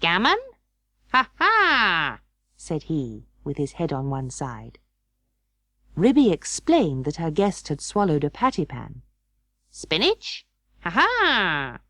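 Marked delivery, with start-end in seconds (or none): 0.0-2.2 a hint of a cackling bird; lightly voiced magpie doctor. The magpie doctor answering Ribby, lightly cackling. 9.7-11.8 a hint of a cackling bird; lightly voiced magpie doctor. The magpie doctor answering Ribby, lightly cackling.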